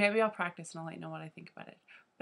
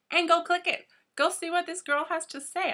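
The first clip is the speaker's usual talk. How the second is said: much higher and louder, eager push; excited urging; 11 semitones higher, 6 dB louder than usual. Real, not acted. Vlogger urging viewers to watch her collab partner, with excited urging. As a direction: hype them into clicking.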